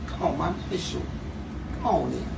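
ordinary talk, nothing marked

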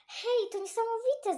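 very high, thrilled cry; excited exclamation. Acted. Gucio amazed at the Roman coin, very high excited cry. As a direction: burst out in amazement. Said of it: "zawołał".

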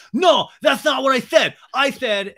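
high, loud indignant protest; hammed-up outraged cut-in; mock protest. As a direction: cut in hard. Acted quarrel, Sam protesting.

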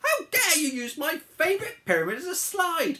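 high, loud imperious cry; outraged queen. Acted. Cleopatra catching pigs on her pyramid, indignant shriek. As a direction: scold them in hammed-up outrage. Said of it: "cried Cleopatra".

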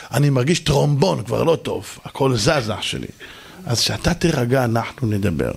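low, placid mock-patient speech; gentle calm voice. Calm man asking a yeller to talk later, gently. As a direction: soothe the shouter in an unbothered low voice. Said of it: "קול כזה עדין".

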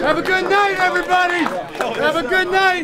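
high, loud send-off; calling out. Real, not acted. Coach sending the team home, calling out. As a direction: call it out to the whole room.

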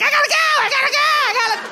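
very high, squealed urgent nagging; frantic cartoon bladder. Cartoon bladder interrupting camping woman, frantic high squeal. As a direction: squeal it over and over in desperate urgency.

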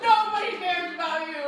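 shouting. Acted. Teasing shout repeating line.

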